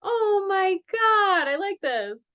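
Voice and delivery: high, slow delighted gush; delighted squeal. Real, not acted. Reader delighted by the story.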